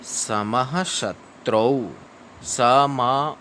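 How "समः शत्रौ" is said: slow syllable-by-syllable Sanskrit, visarga sounded as ha; slow recitation. Shown as a demonstration. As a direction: carefully spell out समः शत्रौ slowly. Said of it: "श्लोक पढ़ेंगे".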